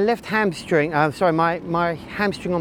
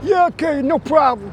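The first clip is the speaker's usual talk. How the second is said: lightly voiced kid, much higher; obedient kid; 8 semitones higher than usual. Cyclist playing the kid answering his coach, mock-meek.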